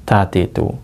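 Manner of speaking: usual speaking voice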